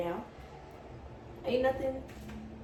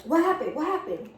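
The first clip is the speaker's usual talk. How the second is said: urgent demand; 6 semitones higher, 4 dB louder than usual. Retold or imitated. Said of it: "I'm like".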